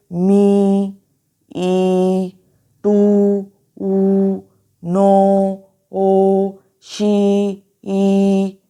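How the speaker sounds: मी, टू, नो, शी each stretched on its vowel, held level; long vowels. Shown as a demonstration. Teacher showing open syllables to students, long drawn-out vowels in मी, टू, नो, शी. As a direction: draw out the vowels in मी, ई, टू, ऊ, नो, ओ, शी, ई. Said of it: "long pronounce हो रहे हैं".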